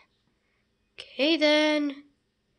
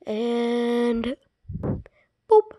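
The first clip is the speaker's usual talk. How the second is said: ordinary talk, nothing marked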